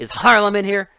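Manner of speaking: high, loud tough-guy demand; brash thug demand. Retold or imitated. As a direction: bark it like a cocky goon. Acting out thug at desk.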